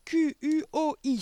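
high, loud, slow letters; shouting at full throat. A narrator's reading. Boy spells to be set free, shouting desperately. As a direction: belt out each letter separately. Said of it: "s'égosat-il".

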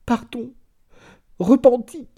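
very high, loud begging cries; cri de repentir. A narrator's reading. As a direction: cry out for forgiveness in desperation. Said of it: "criait la comtesse". (cris suppliants, très aigus)

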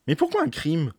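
put-on a young man blurting out a question; rash interruption. A narrator's reading. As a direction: cut in thoughtlessly with the question. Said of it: "interrompit étourdiment Rémy".

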